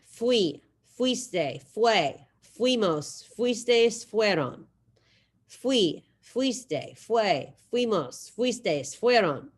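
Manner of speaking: rhythmic Spanish drill recitation; choral drill. Shown as a demonstration. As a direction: chant each form for the class to say along. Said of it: "So say it with me".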